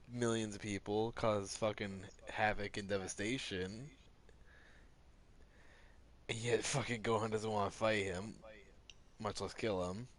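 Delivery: normal talking voice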